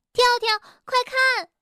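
high, loud excited shout; bright cry to point out the sky; excited call. A narrator's reading. Rabbit calling to his friend to look up, excited. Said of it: "蹦蹦兴奋地喊道".

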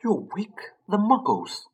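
urgent whispered warning, pitched high; hissed warning. A narrator's reading. McGonagall warning Hagrid not to wake the Muggles, urgent whisper. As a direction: hiss it so nobody wakes. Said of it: "hissed Professor McGonagall".

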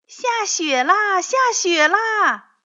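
high, loud, slow joyful cheer; drawn-out happy cry. A narrator's reading. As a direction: shout it out with delight at the snow. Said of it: "欢呼着".

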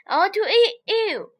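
lion calling out for prey; hungry lion. Acted. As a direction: call out your hunger. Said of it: "roared lion".

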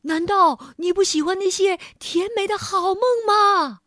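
panting question; heavy breathing. A narrator's reading. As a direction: gasp the words out, breathless. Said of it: "国王大口喘着气问". (大口喘着气)

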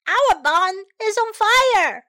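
high, loud alarmed shout. Acted. Penny the pig shouting to Smokey and Kitty. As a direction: yell it out in alarm. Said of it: "shouted Penny".

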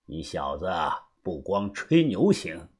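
low, soft character voice with a chuckle before it; quietly impressed older mentor; knowing elder, amused. Acted. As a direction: give grudging praise in a low, unhurried tone. Voices Uncle Xu, amused praise.

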